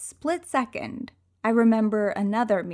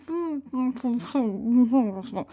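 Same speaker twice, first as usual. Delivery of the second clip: amused laugh; 2 semitones higher than usual. Real, not acted. Laugh after taunt.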